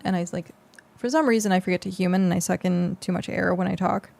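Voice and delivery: ordinary talk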